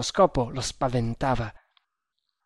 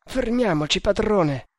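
higher, urgent plea; pleading; 6 semitones higher than usual. A narrator's reading. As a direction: beg him to stop. Servant begs master to stop.

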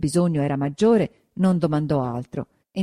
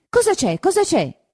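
much higher, urgent repeated question; high, hurried alarm; anxious asking; 13 semitones higher, 5 dB louder than usual. A narrator's reading. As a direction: feign ignorance while holding her back. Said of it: "disse Agnese".

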